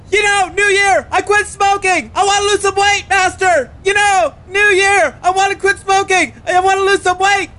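drill-style shouted reply. Acted. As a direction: bark it back at the drill master. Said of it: "Like you are being spoken to by your master".

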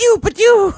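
very high mock blaster sounds; laser gun noises. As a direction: make cartoon laser shots. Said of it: "when he was going".

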